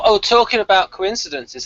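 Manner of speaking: normal talking voice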